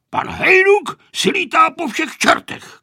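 high, loud irate grandfather; shouting. A narrator's reading. Grandfather shouting angrily about the pilot. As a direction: yell it in a worked-up high voice. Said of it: "křičel děda Kozelka".

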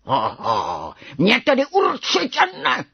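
defiant roar leaping high; fierce tiger cub shouting back; young tiger's roar. A narrator's reading. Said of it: "zařval malý tygr".

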